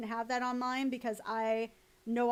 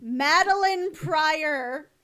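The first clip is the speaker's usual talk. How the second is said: swooning; 3 semitones higher, 6 dB louder, much slower than usual. Real, not acted. Swooning over a character.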